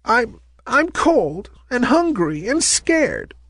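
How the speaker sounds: small, high, slow forlorn voice; pitiful. A narrator's reading. Lost ballerina answering a kind stranger, pitiful. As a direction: plead pitifully, high and halting.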